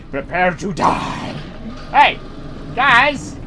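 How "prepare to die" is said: mock-menacing threat. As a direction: intone it low and ominous. Villain threat, first take.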